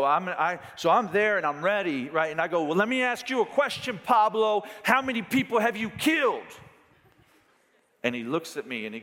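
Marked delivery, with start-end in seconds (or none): 2.6-6.4 higher, louder, eager challenging question; preacher gleefully challenging the tattooed man; bold challenge. Retold or imitated. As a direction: fire the question at him with gusto. Preacher quoting his own challenge.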